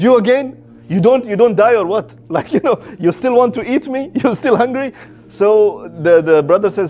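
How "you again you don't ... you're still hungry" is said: high-pitched, incredulous questioning with laughs; acted disbelief. Egyptian brother facing the revived man again, in comic disbelief. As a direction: question the man as if he refuses to stay dead.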